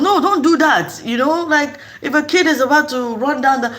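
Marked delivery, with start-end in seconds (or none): 0.0-1.0 high, firm mock scolding; firm telling-off. Telling a child to stop, mock-firm scolding. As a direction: correct the child directly, acted out. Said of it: "tell that child".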